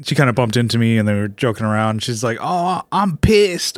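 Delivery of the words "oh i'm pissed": put-on English accent. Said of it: "she was English".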